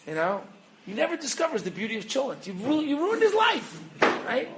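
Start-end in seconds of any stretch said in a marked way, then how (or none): 2.5-4.5 high-pitched comic accusation; hammed-up indignant wail of blame; mock outrage. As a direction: accuse in rising, exaggerated outrage. Mock accusation ends the tale.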